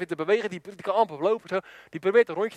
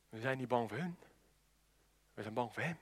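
very low, hushed confession; timid soldiers; 10 semitones lower, 16 dB quieter than usual. Retold or imitated. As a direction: admit your fear under your breath. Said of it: "met knikkende knietjes". (bedeesd en heel zacht, laag)

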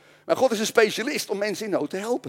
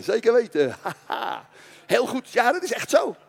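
ordinary delivery

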